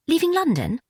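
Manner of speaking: high startled question; shocked young woman, voice jumping up; surprised. A narrator's reading. Sister-in-law surprised.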